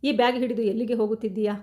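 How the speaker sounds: ordinary delivery, nothing marked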